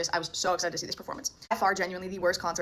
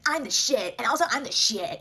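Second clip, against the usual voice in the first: higher-pitched mimicry of a show-off; mock cockiness; 4 semitones higher, 3 dB louder, slower than usual. Singer bragging on stage, mocking swaggery impression. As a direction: imitate a singer full of himself. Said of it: "he's up there just being like".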